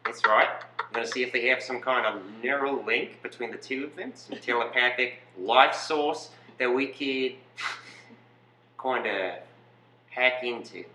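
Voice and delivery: mock Australian Nazi scientist; casual put-on Australian twang; Australian accent. Scientist explaining the twin experiment, mock Australian accent. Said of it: "What about Australian?".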